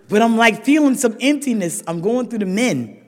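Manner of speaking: usual speaking voice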